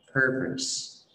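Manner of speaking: "purpose" stressed on PUR, weak hushed second syllable; correct stress. Shown as a demonstration. Teacher modelling the correct word, first-syllable "purpose". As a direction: hit PUR, let "pose" shrink to "pus". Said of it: "cái từ này mình sẽ đọc là".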